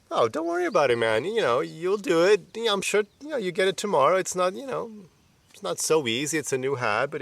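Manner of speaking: a best friend comforting, upbeat and kind; friendly reassurance. Retold or imitated. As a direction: cheer up a friend who slipped. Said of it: "that kind of, like, friendly talk".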